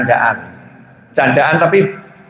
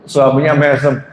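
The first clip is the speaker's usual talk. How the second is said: ordinary talk, nothing marked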